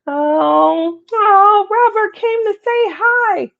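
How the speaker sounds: high, excited coo; delighted coo. Real, not acted. Welcoming a viewer who dropped in, gushing high. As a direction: gush over a friend's visit.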